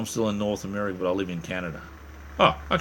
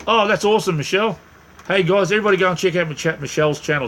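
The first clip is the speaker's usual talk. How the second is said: excited shout-out; 9 semitones higher, 5 dB louder than usual. Real, not acted. Thanks a supporter and gives her a shout-out.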